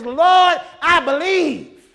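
loud, slow cry to the Lord; desperate father crying out to Jesus. Acted. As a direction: call out to him, drawn out and loud. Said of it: "he cried out to Jesus".

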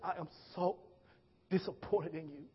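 very soft, low, weighty disappointment; trembling mama. Retold or imitated. Mother telling son she is disappointed, hushed and trembling. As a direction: let each phrase fall quietly, with pauses. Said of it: "Her voice is trembling".

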